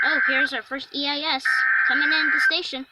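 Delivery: a sudden burst of alarm; excited. Real, not acted. First emergency alert arrives on stream.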